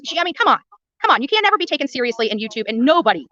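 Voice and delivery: ordinary delivery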